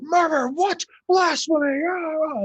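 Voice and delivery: a scandalised tribesman crying out; outraged crowd. Acted. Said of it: "there are people like".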